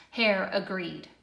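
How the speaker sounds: ordinary delivery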